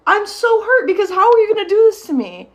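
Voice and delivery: high, loud, wounded outburst; hurt protest. Retold or imitated. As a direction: plead in hurt indignation. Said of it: "I'm hurt. I start crying".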